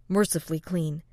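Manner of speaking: usual speaking voice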